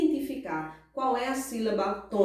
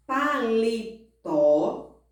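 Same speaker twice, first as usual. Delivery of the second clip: "paletó" slowly split pa-le-TÓ; stress on last syllable; much slower than usual. Shown as a demonstration. Teacher gives oxytone example, slowly stressing last syllable. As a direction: spell out "paletó" by syllables, firm final tó. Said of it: "elas têm a última sílaba mais forte".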